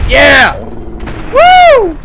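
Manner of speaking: loud, very high cheering; victory whoop. Real, not acted. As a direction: whoop in triumph. Winning the fight.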